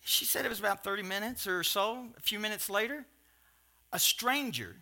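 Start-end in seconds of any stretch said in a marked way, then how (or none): none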